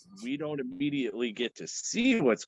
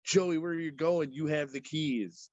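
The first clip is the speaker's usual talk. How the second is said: mock frightened plea; 7 semitones higher than usual. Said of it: "you hear her say".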